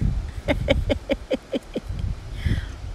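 long, voiceless chattering monkey laugh; monkey giggle. A narrator's reading. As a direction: run off a string of soft monkey titters.

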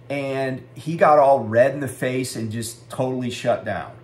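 ordinary talk

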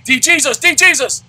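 high, loud chant of the player's name; excited shout. Real, not acted. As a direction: scream the name like a fan in the stands. Cheering player on at bat.

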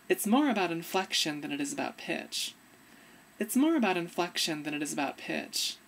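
ordinary delivery

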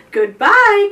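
high, loud farewell call. A narrator's reading. Children to the departing dugong, calling out. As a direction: call goodbye across the water. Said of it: "called Benji and Belle".

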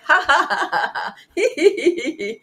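high, loud fake ha-ha into hee-hee; simulated laughter. Shown as a demonstration. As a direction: force out a demonstration laugh, ha-ha then hee-hee. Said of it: "real and simulated laughter".

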